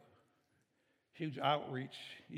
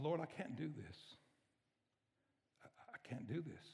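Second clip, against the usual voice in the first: despairing prayer; 7 dB quieter than usual. Retold or imitated. Retold despairing prayer at hospital.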